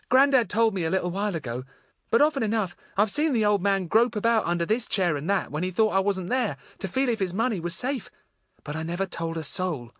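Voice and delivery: gently voiced young girl. A narrator's reading. Voices Marion.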